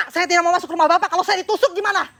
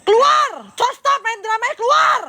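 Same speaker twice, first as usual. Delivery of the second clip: shouting demands; 5 semitones higher, slower than usual. Real, not acted. Vlogger shouting at the family to send the girl out. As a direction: yell at them to quit the act.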